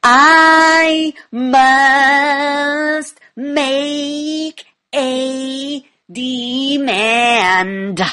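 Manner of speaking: very slow, every word stretched, loud and high; big booming held notes on each word; forceful drawn-out demand. Shown as a demonstration. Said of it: "I could say".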